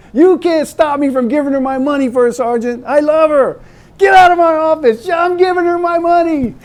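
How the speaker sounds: high, loud defiant outburst; defiant yelling. Retold or imitated. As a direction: shout him down, stubborn in love. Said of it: "He says".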